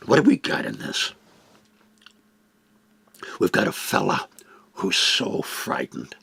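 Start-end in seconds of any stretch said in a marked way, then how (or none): none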